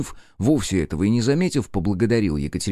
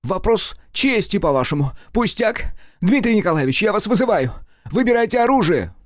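furious challenge; 11 semitones higher than usual. A narrator's reading. Nikitin challenging Rudnev to a duel, furious. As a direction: throw down the gauntlet.

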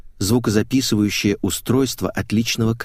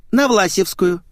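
high, small-voiced answer; reply as a young girl, pitched up; girlish voice; 10 semitones higher than usual. A narrator's reading. Girl names her destination to driver, high girlish voice. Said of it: "ответила девушка".

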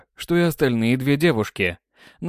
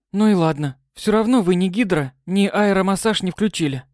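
sulky declaration; 7 semitones higher than usual. Acted. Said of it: "заявила отставшая".

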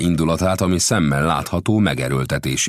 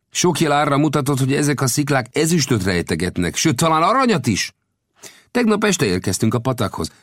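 higher-pitched voice for the gambusino, earnest storyteller; gambusino's voice; 11 semitones higher, 4 dB louder than usual. A narrator's reading. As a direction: tell the tale as the friendly gold digger. Gambusino recounts ambush.

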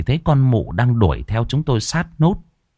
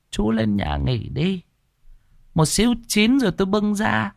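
a hint of a sly old granny; old woman's voice; 10 semitones higher, 4 dB quieter than usual. Old woman sending the guest upstairs, lightly voiced sly old woman.

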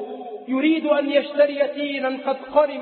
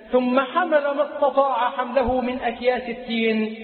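normal talking voice

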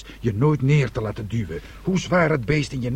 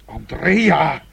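stern call; 4 semitones higher, 4 dB louder, much slower than usual. Acted. Father rebukes daughter.

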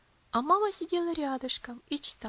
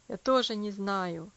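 put-on low slow boy; 4 semitones lower, 4 dB louder than usual. A narrator's reading. Gary's low slow reply.

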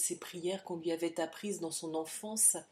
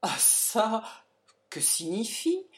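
shocked father; 4 semitones higher, 5 dB louder, slower than usual. Acted. Father shocked by formal address.

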